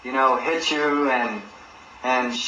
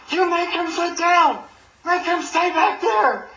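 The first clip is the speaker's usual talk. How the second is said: high shrill-free squeal of panic, way up; mock frantic prosecutor. Prosecutor ordering the bailiff, in a high panicky mock yell. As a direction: play the panicked prosecutor yelling, pitched way up.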